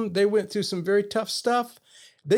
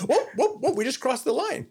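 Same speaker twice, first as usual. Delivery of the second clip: sing-song high warning whoop; mock alarm, a teasing siren call; playful alarm; 6 semitones higher than usual. Father flags daughter ramping up.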